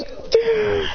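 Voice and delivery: high acted grunt of impact; hit reaction. Figure gets hit.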